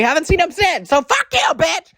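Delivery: rising to a high, loud yell; angry outburst. Real, not acted. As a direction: build into a shrieked insult. Angry aside at the absent uncle.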